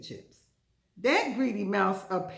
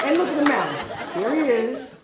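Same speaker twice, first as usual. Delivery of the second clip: excited; 6 semitones higher, 7 dB louder than usual. Real, not acted. Excited laughing commentary.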